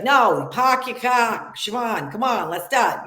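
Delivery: slow exaggerated Boston accent; park your car, Boston style. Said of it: "she's from Boston".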